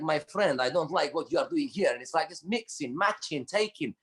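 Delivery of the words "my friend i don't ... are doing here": put-on Eastern European English; Eastern European accent. Comedian mimics an Eastern European householder addressing the visiting repairman, Eastern European accent. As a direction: imitate an Eastern European man objecting. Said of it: "Eastern European".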